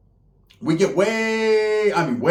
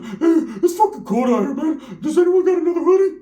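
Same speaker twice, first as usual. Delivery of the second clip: higher, louder whining complaint; whiny cold cousin; 11 semitones higher, 3 dB louder than usual. Retold or imitated. Cousin soaked from the canal, whining about the cold. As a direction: whine like you are freezing and soaked.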